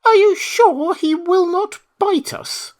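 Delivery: very high, slow, timid squeak; timid mouse. Acted. Mouse queen peeks out from the grass, timid. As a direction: ask fearfully, peeking out. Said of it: "asked in a timid voice".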